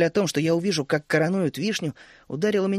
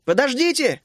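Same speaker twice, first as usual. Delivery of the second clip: calling after; 8 semitones higher, 3 dB louder than usual. A narrator's reading. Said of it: "крикнул я ей вслед".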